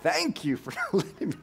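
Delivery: grateful prayer breaking into a laugh; looking back relieved, laughing thanks to God. Retold or imitated. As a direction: thank the Lord while cracking up. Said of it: "we're like".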